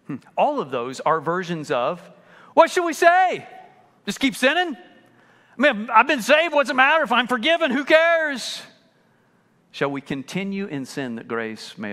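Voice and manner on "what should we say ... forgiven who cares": higher, louder mock voice; hammed-up careless believer. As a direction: sneer it like someone who doesn't care.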